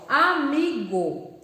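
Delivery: Amigo drawn out very slowly, final O fully sounded; Amigo, slow teacher's model with a full O; careful word ending. Shown as a demonstration. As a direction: stretch Amigo so the closing O is clear. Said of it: "uma palavra com terminação de O".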